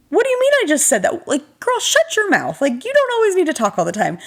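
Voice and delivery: high, fast scolding of her past self; mock self-scolding. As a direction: scold yourself in a high teasing voice. Mock-scolding herself on call.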